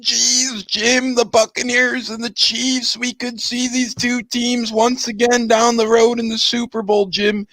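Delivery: high-pitched mock sportscaster, sustained throughout; Tony Romo impersonation. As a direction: put on an excitable TV analyst's voice, pitched well up. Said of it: "that's a terrible impersonation, by the way".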